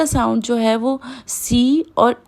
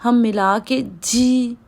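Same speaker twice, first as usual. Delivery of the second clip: ordinary talk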